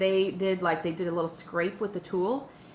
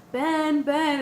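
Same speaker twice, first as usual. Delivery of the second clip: raised, higher calls of Ben; calling Ben, louder and higher; 9 semitones higher, 6 dB louder than usual. Retold or imitated. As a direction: call out desperately for Ben. Calling for husband in OR.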